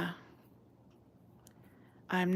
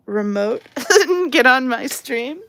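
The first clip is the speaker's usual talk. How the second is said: breaking into laughter mid-sentence; higher, louder, laughing through the words; 4 semitones higher, 8 dB louder than usual. Real, not acted. Streamer inviting a viewer onto the stream, laughing.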